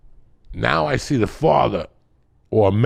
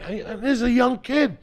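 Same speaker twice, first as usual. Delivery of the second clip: ordinary talk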